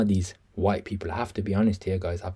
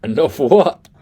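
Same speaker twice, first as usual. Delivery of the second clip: louder, higher mimicry of a challenge; indignant fighter, imitated; mock echo of fighter; 6 semitones higher, 10 dB louder, slower than usual. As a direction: throw it back as a loud mock question. Mimicking the clip just played.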